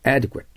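teacher modelling Adequate; careful pronunciation demo. Shown as a demonstration. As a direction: model the word Adequate for students to repeat. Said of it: "発音してください".

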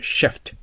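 "シフト" opening on a strong hissed English sh; rounded "sh" sound. Shown as a demonstration. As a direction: say "シフト" as English "shift", not Japanese shi. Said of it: "シュで発音しないといけません".

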